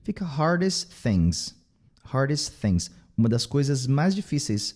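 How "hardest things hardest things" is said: "hardest things" run together as "hardes things"; dropped T. Shown as a demonstration. Teacher shows learners the linking, T dropped. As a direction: drop the T of "hardest" before "things". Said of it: "esse T vai cair".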